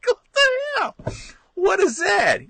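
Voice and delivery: high, laughing disbelief; amused outrage. Retold or imitated. Reacting to grandpa's AC, high laughing outrage. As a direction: yelp it out in mock outrage. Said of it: "I was like".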